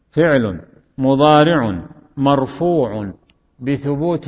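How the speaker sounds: slow, in separated chunks; slow teaching repetition. Shown as a demonstration. Teacher drilling the parsing method, slow and segmented. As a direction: spell out each grammatical term deliberately. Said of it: "أعيد".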